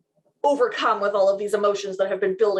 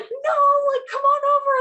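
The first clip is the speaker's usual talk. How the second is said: high, eager mock-mom welcome; excited; 4 dB louder than usual. His mom inviting the new fiancée over, excited. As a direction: imitate a thrilled mother, pitched way up. Mom woken with engagement news.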